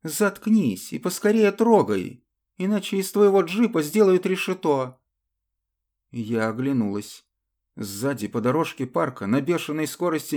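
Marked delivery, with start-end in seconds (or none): 0.0-5.0 commanding shout. Acted. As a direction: bark the order, urgent. Woman orders driver to hurry.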